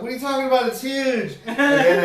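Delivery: hammed-up defensive denial; indignant denial. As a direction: protest loudly in fake disbelief. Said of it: "First, you be denial".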